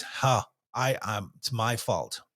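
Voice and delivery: low, soft, hesitant admission; taking the blame. Shown as a demonstration. Taking ownership with someone wronged, quiet admission. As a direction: let the voice sink and accept fault. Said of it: "we take it on us".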